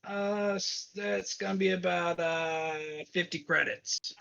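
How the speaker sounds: lightly voiced cab driver; casual gruff cabbie.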